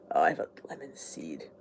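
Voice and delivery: hushed unvoiced aside to herself; a quick private whisper. Real, not acted. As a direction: whisper it under your breath. Muttered aside while eating.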